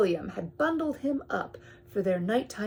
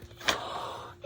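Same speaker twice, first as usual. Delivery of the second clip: gasp; 5 dB quieter than usual. Acted. Gasp before eclipse reveal.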